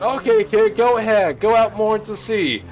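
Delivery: high, loud egging-on shout; dads yelling. Retold or imitated. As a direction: jeer the kid farther out. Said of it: "who were just yelling".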